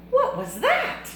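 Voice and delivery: very high, loud surprised question; startled kitten. Acted. Kitten startled by his reflection, high squeaky question. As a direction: squeak it out in alarm.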